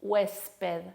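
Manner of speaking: "huésped" with a clear hissed s before the p; careful, sibilant s in "huésped"; fully pronounced S. Shown as a demonstration. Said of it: "no decimos".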